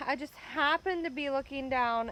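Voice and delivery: ordinary delivery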